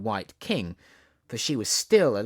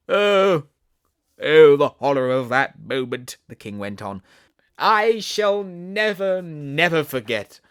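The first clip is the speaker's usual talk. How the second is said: loud hammed-up horror, slow; king wailing; 8 dB louder than usual. King tells Queen his horror, loud melodramatic wail.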